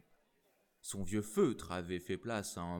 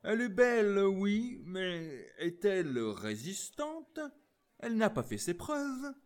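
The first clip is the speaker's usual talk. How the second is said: higher, loud, a mock-casual officer's voice; sneering light-cavalry officer, voiced higher; detached tone; 10 semitones higher, 5 dB louder than usual. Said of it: "d'un ton détaché".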